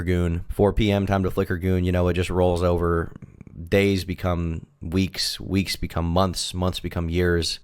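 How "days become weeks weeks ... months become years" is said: slow, weighty melodrama; slow dramatic. Acted. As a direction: drag each phrase out like a tragic confession.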